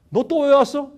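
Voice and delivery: loud, high demand; stern challenge. Retold or imitated. God challenges the devil at the assembly, loud questioning.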